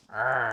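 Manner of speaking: short strained growl; effort growl. Real, not acted. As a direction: growl as you tug at it. Struggling with onion.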